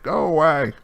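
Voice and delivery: high, loud call through a door; voice from inside. Acted. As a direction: shoo visitors away from indoors. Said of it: "you hear a faint voice inside".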